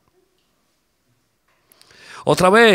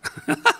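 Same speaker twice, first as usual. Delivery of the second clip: short laugh. Real, not acted. Laughs after his excuse.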